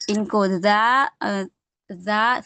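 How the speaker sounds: زا drawn out slowly and softly; teacher stretching the letter za softly. Shown as a demonstration. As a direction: stretch the آ in زا gently. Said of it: "سا اور زا soft پڑھے جاتے ہیں".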